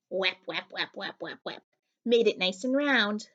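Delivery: usual speaking voice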